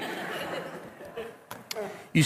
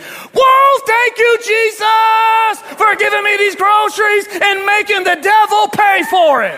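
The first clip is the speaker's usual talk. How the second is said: very high, loud jubilant shout; triumphant praise; 14 semitones higher, 6 dB louder than usual. Acted. Praying woman answers the prankster after a pause, triumphant praise. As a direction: belt out thanks, stretching the opening words.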